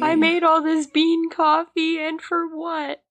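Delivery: high-pitched fake lament; mock crying. Joining the joke about crying over coffee, mock crying. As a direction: wail it like a hammed-up breakdown. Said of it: "start crying".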